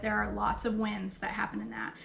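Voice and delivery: ordinary delivery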